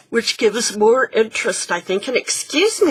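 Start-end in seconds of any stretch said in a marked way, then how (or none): 2.1-2.9 जम्हाई लेते हुए बोलना; words stretched through a yawn. Real, not acted. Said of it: "I don't know why I'm yawning".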